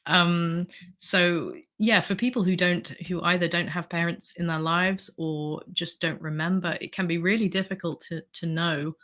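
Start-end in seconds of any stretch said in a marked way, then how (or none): none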